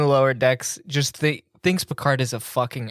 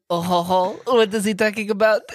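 higher-voiced, hammed-up French parent; baffled parent in a broad mock French accent; 5 semitones higher than usual. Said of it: "the most stereotypical French people ever".

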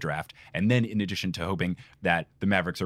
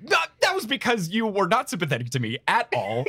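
high laughing protest; laughing mock outrage; 10 semitones higher than usual. Real, not acted. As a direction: protest while laughing, half shouting.

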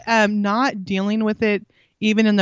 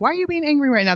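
pitched up, accusing why; accusing question; 7 semitones higher, faster than usual. Shown as a demonstration. Mom demos the defensive-making question, accusing. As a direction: challenge the kid on why they're angry. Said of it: "puts them on the defense".

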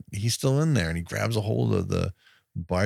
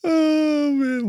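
high, loud disbelieving oh man; stunned oh man; 5 dB louder than usual. Real, not acted. Reacting to the dog shooting with a high, loud oh man. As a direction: groan it out in disbelief.

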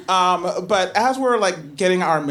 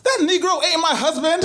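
higher, laughing, indignant woman; 10 semitones higher than usual. Retold or imitated. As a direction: voice her outraged denial. Woman denies husband, laughing.

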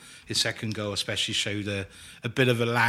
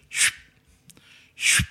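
short hushed "shoo" whoosh; imitated ball whoosh. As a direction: mimic a cleanly struck ball flying past. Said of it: "kept hearing this kind of".

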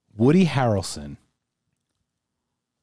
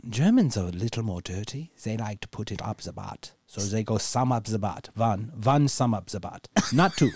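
a light mock German accent, w said as v; stiff, proper German douchebag. Deutschmark insists on British English, light mock German accent. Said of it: "I just channeled some German douchebag".

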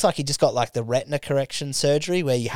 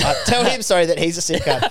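laughing through the words; giggling mid-sentence; 5 semitones higher, 3 dB louder than usual. Real, not acted. Laughing while retelling joke.

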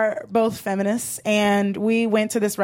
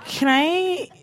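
put-on hesitant drunk with long pauses; drunk man; 4 dB quieter, slower than usual. Imitating a drunk asking to touch her hair, halting and sighing. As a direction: stall and sigh like a drunk. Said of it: "that kind of drunk where".

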